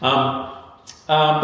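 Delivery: ordinary talk, nothing marked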